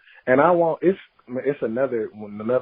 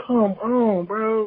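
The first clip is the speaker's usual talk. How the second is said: exasperated; 8 semitones higher, much slower than usual. Real, not acted. Friend scolds the clueless husband in exasperation. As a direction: plead with him in disbelief.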